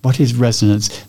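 usual speaking voice

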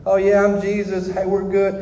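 a chameleon putting on easy holiness; phony church act. Retold or imitated. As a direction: play the fake believer brushing it off. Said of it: "I was always a chameleon".

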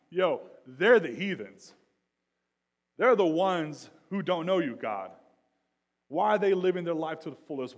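loud, high, impassioned protest; indignant preacher shouting a plea; anguished complaint to God. Acted. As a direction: cry out to God, building to a shout. Acts out angry complaint to God.

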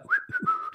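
high, soft whistled notes; innocent whistling. Acted. Acting out the character sitting there, nonchalant whistling. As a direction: whistle softly as if nothing happened. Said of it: "like, whistling, like".

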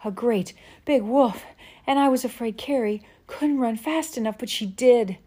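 high, loud breathless rush; excited. Acted. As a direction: blurt it out excitedly. Breathless girl reporting wolf.

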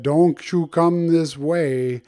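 slow, drawn-out warning; mildly menacing slow alligator voice; angry alligator. Acted. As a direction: warn them off as a storybook alligator, stretching the words. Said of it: "the angry alligator said".

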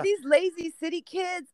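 high-pitched retold parental grumble; mock scolding. Parent retelling a rant at his son, mock scolding. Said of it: "yell at my son".

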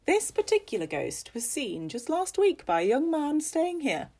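high, emphatic, lilting storyteller; animated ghost-story telling with big pitch swoops; storyteller Missus Fox. Acted. Missus Fox telling guests about a ghost, animated storyteller.

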